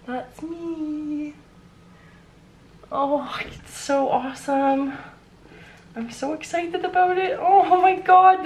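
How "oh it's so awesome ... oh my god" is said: overwhelmed. Real, not acted. Overwhelmed holding her book.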